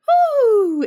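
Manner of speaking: very high loud hoo dropping down; vocal roller coaster hoo. Shown as a demonstration. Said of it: "the, like".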